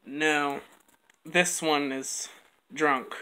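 normal talking voice

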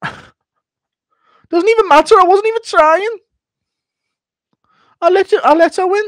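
squeaky defensive insistence; Darren Till excuse. Retold or imitated. Darren Till insists he let his daughter win, squeaky whine. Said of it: "Darren Till explaining how he's still".

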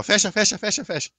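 high urgent repeated call. Shown as a demonstration. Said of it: "eu só vou gritar assim".

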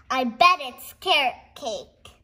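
ordinary delivery

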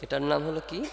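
ordinary talk, nothing marked

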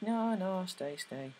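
high, pleading no no, falling on stay. Retold or imitated. Olive begs Nancy not to leave, high pleading. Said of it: "And Olive's like".

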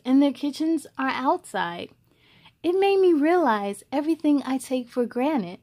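clear, even read-aloud voice; story narration. A narrator's reading. Host reading a picture book to young viewers, storytelling voice.

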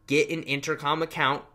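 normal talking voice

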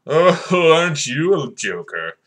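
mock the would-be joker with a big "oh"; sarcastic mock amusement. Host scoffing at the viewer's imagined joke, sarcastic.

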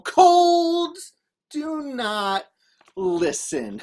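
slow, word by word; drawn-out emphasis. A narrator's reading. Telling kids colds won't listen, slow emphatic delivery. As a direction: stretch each word for effect. Emphatic punchline after the blow.

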